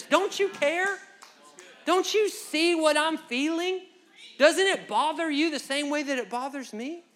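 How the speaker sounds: high, loud desperate questions; loud pleading Martha. Retold or imitated. Martha loudly demands that Jesus share her worry. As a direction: cry out for him to feel it. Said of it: "Martha is saying".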